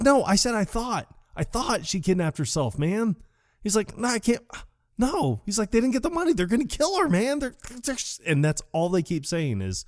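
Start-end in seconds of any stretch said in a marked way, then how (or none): 0.0-3.2 high, whiny pleading voice; strained high-pitched insistence; mock exasperated protest. Dude protests in acted argument. 4.0-8.2 very high, frantic pleading; hammed-up squeaky distress; mock panic.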